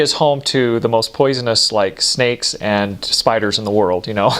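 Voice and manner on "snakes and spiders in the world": usual speaking voice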